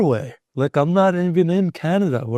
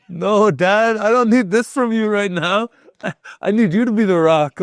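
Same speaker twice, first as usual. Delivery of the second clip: upset plea; 9 semitones higher than usual. Retold or imitated. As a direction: beg your father to hold it together. Pleading with dad after the news.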